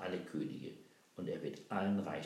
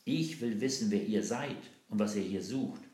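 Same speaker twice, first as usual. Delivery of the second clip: louder, higher interrogating captain; angry demand; 4 semitones higher, 8 dB louder than usual. Acted. Captain demands who the children are, loud and angry. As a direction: bark the demand at them.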